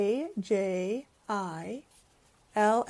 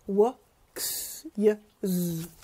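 plain reading voice

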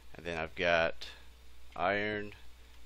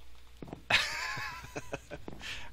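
manic high giggle. Real, not acted. Manic laugh after wired remark.

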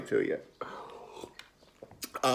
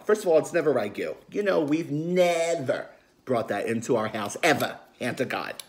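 ordinary talk

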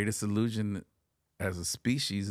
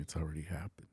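breathy hushed whisper; alien's whispered reveal; 4 semitones lower, 8 dB quieter, faster than usual. Retold or imitated. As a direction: drop to a secret whisper. Alien speaks telepathically, whispered.

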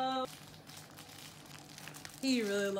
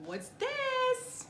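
higher excited question; higher excited coo; 13 semitones higher than usual. Real, not acted. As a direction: ask with wide-eyed delight. Excited reveal.

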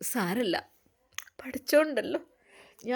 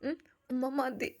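much softer, slow and hushed; soft refusal; 10 dB quieter than usual. Acted. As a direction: murmur it's enough, barely voiced. Daughter softly refusing more food. (പതുക്കെ, മൃദുവായി നിരസിക്കുന്ന മകൾ)

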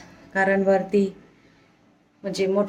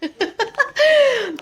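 high, bright burst of laughter; fond laugh; 4 dB louder than usual. Real, not acted. Mother laughing at her son's jelly complaint.

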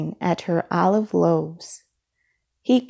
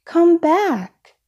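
much higher pleading call; shouting; 9 semitones higher, 3 dB louder than usual. A narrator's reading. Midas begs his vanished family to return, a high shout. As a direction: cry out for them to come back. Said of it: "he shouted".

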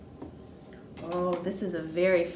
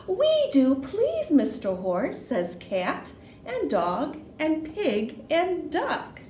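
put-on lively storytelling for small children with swooping pitch; animated children's story narration; picture book read-aloud. A narrator's reading. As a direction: read it playfully, punching the sound words.

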